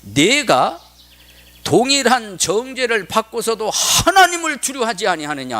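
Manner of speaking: high, mildly stern challenge; preacher acting out a rebuke; rebuking. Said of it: "그를 꾸짖으며 이르되". (높은 어조로 꾸짖는 흉내)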